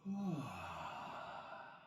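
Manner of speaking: long falling sigh of release. Real, not acted. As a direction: sigh it all out slowly. Resting after bridge pose.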